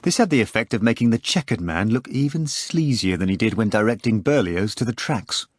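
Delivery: normal talking voice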